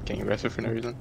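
whispering. Real, not acted. Quiet joke during tense round.

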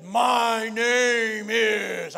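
loud, very slow, drawn-out demon voice; possessed man's voice. Retold or imitated. Preacher imitates possessed man introducing his demon, loud ponderous drawl. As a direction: stretch each word out like a devil announcing itself. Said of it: "sounded like a Swedish accent".

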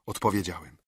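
ordinary delivery, nothing marked

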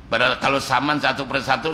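normal talking voice

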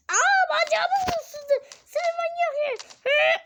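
put-on high, loud, slow child's wail; crying little kid. Bitten little one crying out for his mother, high wailing child. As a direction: whimper for mommy, way up high. Bitten character cries for mom.

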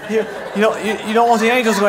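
ordinary delivery

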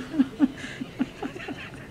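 quiet breathy giggle rising up high; real giggle at still being dressed; airy giggle. Real, not acted. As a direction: let out a soft high giggle.

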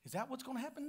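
ordinary delivery

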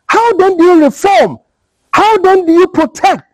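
high, pleading demands for answers; impassioned rhetorical questions. Real, not acted. Rising rhetorical questions in rant.

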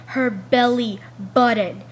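BELLY BUTTON hammered out slowly and loudly; emphatic repeat. Real, not acted. Student griping about the principal's tiny clothes, emphatic. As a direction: spell out "belly button" loud and slow, in disbelief.

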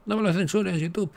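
high, pleading mock voice; mock plea to YouTube. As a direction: beg the platform with playful fake panic.

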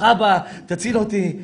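normal talking voice

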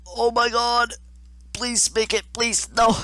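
loud, very high squeal; panicked pleading. Real, not acted. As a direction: plead desperately at the top of your range. Desperate pleading as level goes wrong.

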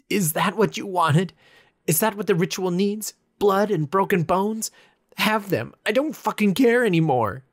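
loud, high bitter rant; furious yelling. A narrator's reading. Bleeding hand, raging at the reaper statue, furious yelling. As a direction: throw every word at the statue.

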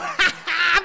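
high, loud cackling laugh; gloating laugh. Real, not acted. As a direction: laugh in their faces. (carcajada burlona y fuerte)

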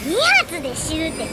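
normal talking voice

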